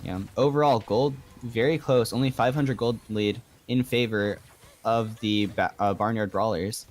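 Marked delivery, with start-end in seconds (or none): none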